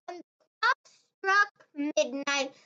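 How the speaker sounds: usual speaking voice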